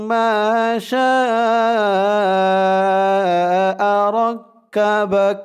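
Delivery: slow chant with شاء held very long; Quran recitation. Shown as a demonstration. Teacher reciting the verse ending, long melodic hold. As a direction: stretch شاء, then ركبك lightly.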